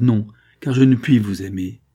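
loud, vehement no; emphatic refusal. A narrator's reading. Wife pulling back from her husband, vehement refusal.

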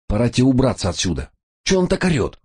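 fast, high and urgent; agitated. Acted. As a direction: burst in alarmed and pressing. Флинн бурно врывается в комнату.

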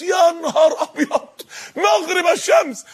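high, drawn-out amazed exclamation; mock astonishment. Preacher reacting to the verse, astonished. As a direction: marvel at it, stretching the words.

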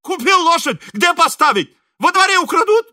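high, loud, furious outburst; sudden anger. A narrator's reading. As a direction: snap back indignantly at the top of the voice. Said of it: "Шаринов вдруг рассердился". (сердитый высокий крик)

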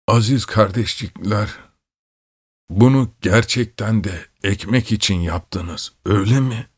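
tremble it out with a rattle in the breath; halting, shaky, wounded man's voice; wheezy, trembling voice. A narrator's reading. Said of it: "hırıltılı, titrek bir ses yükseldi".

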